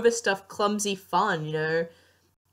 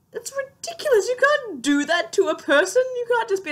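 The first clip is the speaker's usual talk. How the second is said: high, indignant outburst; scandalized ranting; exasperated protest; 4 dB louder than usual. Real, not acted. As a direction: pitch leaps up in disbelief. Ranting about Wynonna keeping secrets.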